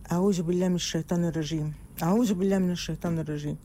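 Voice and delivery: low, fast muttering; fast muttered prayer. Pretend. Calming yourself mid-fight, low fast muttered prayer. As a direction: rattle off the prayer under your breath. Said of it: "الخناقة ابتدت".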